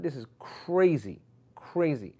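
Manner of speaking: slow, stretched mild dismay; exasperated disbelief. Retold or imitated. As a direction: drag out the key word, appalled. Retold dismay at zonked-out students.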